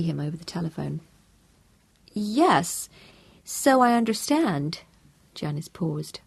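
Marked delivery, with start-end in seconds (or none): 2.1-4.8 higher, slow American wife; lightly voiced neighbour's wife; Janice's voice. A narrator's reading. Janice answers Tom on the phone, a touch higher.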